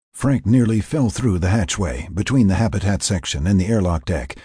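usual speaking voice

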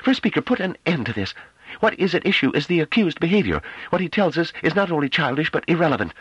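tense, tightly controlled, high. A narrator's reading. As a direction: hold the fury barely in check. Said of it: "a tense but tightly controlled voice".

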